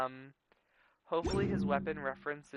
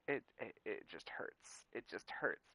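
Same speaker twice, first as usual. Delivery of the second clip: sad sigh; 3 semitones lower, 8 dB quieter than usual. Real, not acted. Grieving over character backstory.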